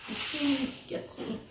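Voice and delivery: real tears, high and broken by little laughs; high, tearful half-laugh; crying. Real, not acted. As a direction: struggle to get words out while crying. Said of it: "I'm crying".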